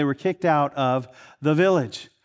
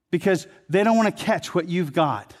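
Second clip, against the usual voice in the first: normal talking voice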